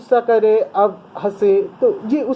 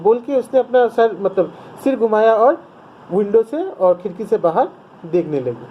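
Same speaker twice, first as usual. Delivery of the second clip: normal talking voice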